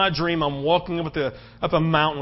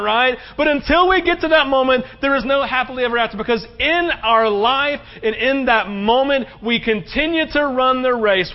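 higher, louder, rising preacher's drive; revival-style sermon delivery; full-force preaching; 8 semitones higher, 5 dB louder than usual. Acted. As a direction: preach it out to the whole room.

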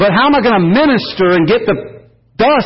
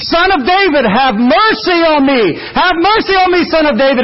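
begging cry; 8 semitones higher than usual. Retold or imitated. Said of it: "and he is begging".

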